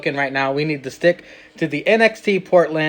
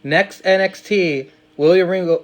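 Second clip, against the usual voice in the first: usual speaking voice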